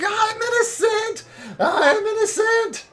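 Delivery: high, drawn-out wailing shout; screaming ghost. Acted. Noosed ghost screaming at the adventurers. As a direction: scream each word long and high. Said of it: "is just screaming".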